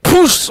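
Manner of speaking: high, loud whoosh of flame; explosive little fire noise; imitated ignition. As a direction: puff out a sudden high "poosh".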